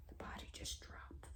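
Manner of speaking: barely voiced, awed aside; very soft and solemn; hushed whisper. Real, not acted. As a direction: whisper it like a revelation. Hushed revelation about death.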